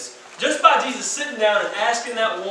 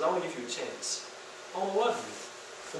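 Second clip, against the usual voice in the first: soft tender offer; 4 semitones lower, 12 dB quieter than usual. Acted. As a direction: say it softly, like a promise. Jesus offering love.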